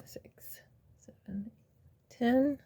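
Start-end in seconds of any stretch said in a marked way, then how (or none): 0.1-1.5 counting under the breath; whispered counting. Real, not acted. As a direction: whisper the numbers.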